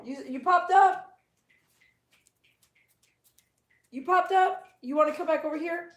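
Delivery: high-pitched questions to a dog; pet-directed coaxing. Real, not acted. Owner asking the dog back over, coaxing baby talk.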